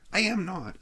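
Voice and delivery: high protesting scream. Acted. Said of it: "screamed Jack Tyler".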